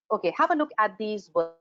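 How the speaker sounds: ordinary delivery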